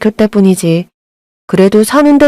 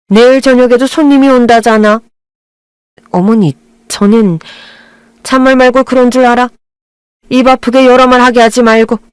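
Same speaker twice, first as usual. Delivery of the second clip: bossy mother-in-law; 4 semitones higher than usual. Acted. Mother-in-law ordering daughter-in-law to stay and serve, bossy higher voice. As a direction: lay down the rules, no room to argue.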